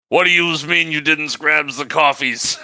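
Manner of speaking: loud, high, hammed-up character voice; Jebediah over intercom. Jebediah demanding coffees over the intercom, loud mock outrage. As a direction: holler it like an outraged cartoon goon. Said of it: "He comes over the intercom like".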